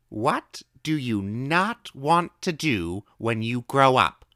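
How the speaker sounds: high, slow, drawn-out question; old woman asking. A narrator's reading. Old woman slowly asking the boy her odd question. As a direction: ask it slowly like a riddle.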